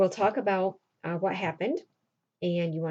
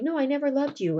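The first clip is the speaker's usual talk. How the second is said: higher-pitched mock protest; acted denial; 7 semitones higher than usual. Cyrano denies his love to Roxanne, protesting. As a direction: protest it in a lifted voice. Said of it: "He's trying to deny it".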